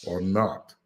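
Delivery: normal talking voice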